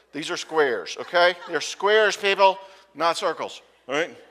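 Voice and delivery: mock-stern loud correction to the congregation; mock scolding shout. Real, not acted. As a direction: loudly correct the joking crowd. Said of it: "joke up here".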